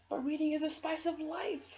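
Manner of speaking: put-on high, wistful little girl; dreamy girl voice. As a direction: say it like a bookworm's motto. Girl defends reading.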